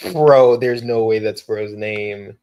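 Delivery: loud, slow incredulous groan of words; real, loud disbelief, dragged slowly; drawn-out disbelief. Real, not acted. Disbelief at an unfamiliar name.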